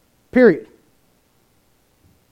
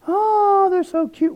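put-on cooing over a baby; 13 semitones higher, much slower than usual. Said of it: "Nowadays we go".

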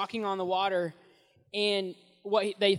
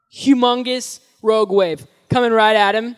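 louder, rising on coming; loud dramatic build; 12 dB louder than usual. Acted. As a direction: sell the danger loudly to the kids. Wave hits Peter in story.

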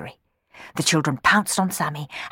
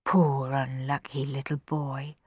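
slow, softer pitying murmur; 2 semitones lower, 6 dB quieter, slower than usual. Acted. Rowan at the window pitying Sammy, soft murmur. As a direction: murmur it tenderly to yourself. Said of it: "murmured Rowan".

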